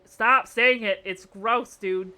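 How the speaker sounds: loud, emphatic plea to stop; disgusted protest. Real, not acted. Fed up with the chat's googie talk, loud protest. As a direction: snap it out loudly in disgust.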